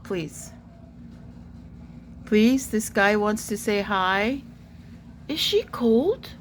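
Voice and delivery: ordinary talk, nothing marked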